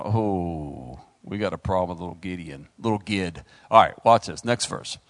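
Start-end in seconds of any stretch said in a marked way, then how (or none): 0.0-3.4 low, quieter mock concern; low, knowing aside; worried family. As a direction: mutter it like relatives fretting over the boy. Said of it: "his family was going".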